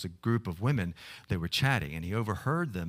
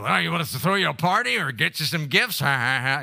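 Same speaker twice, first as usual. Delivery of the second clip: high, loud, jeering voice ending in laughter; hammed-up mocking ladies; 9 semitones higher, 9 dB louder than usual. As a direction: scoff at her, then cackle. Women mocking Agnes's birthday.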